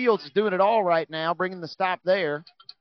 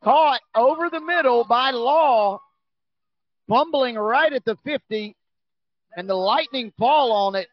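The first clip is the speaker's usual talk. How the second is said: excited turnover call; 4 semitones higher, 3 dB louder than usual. Real, not acted. Commentator tells listeners of another turnover, excited.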